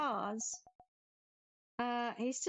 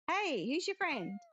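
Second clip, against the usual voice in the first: ordinary talk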